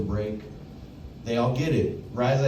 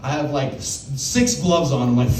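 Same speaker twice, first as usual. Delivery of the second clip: usual speaking voice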